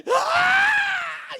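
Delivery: very high, loud scream; acted furious scream; roar of rage. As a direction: let anger burst out in one long shriek. Acts out his rising rage.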